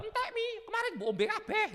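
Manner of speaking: put-on high, cheeky child's voice; child's reply. Child telling mother the pills are gone, high and cheeky. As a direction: answer mom like a kid.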